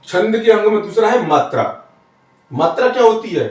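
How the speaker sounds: normal talking voice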